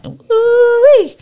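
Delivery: loud, high whooping cheer; excited cheer. Pretend. Heaven's crowd cheering as Kamisha arrives, loud whoop. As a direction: whoop like a crowd greeting a hero. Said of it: "looking at each other going".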